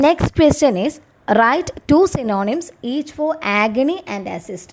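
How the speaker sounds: slow, measured reading of an exam question for students; slow dictation reading. Acted. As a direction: read it out slowly, word by word, like dictating to a class.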